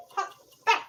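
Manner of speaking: hot bite still in mouth; mouth full of hot food. Real, not acted. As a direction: breathe out around the heat. Still chewing hot chicken.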